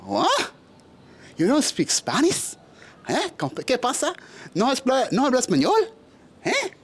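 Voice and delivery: high-pitched imitation of puzzled locals, every question rising; baffled Spanish-speaking locals. Mexicans hearing he doesn't speak Spanish, imitated high-pitched bafflement. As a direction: mimic disbelief in a high, questioning voice.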